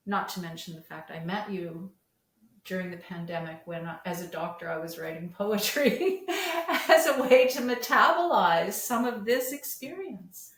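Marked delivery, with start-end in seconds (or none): 5.4-10.5 loud, high burst of laughter mid-sentence; amused outburst carried through the phrase; laughing. Real, not acted. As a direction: laugh as you talk, voice jumping up and louder. Laughs at own poetry writing.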